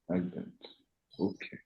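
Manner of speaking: soft, low, peaceful sound. Shown as a demonstration. Teacher modelling a soft sound for the class. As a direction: breathe it out gently. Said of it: "soft, low, and peaceful".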